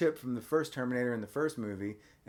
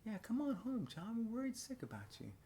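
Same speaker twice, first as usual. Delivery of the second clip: much higher, softer sugary mom voice; mock foster mom; 10 semitones higher, 10 dB quieter than usual. Dad imitating the foster mom on the phone, sugary and high. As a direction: coo it like a fake-sweet mother. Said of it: "She's like".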